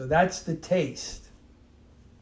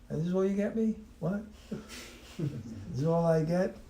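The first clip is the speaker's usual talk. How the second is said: mock disappointed recipient; a touch of spoiled indignation; entitled, ungrateful; 4 semitones higher, 4 dB quieter than usual. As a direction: question the gift as beneath you. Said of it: "feeling entitled, you're proud".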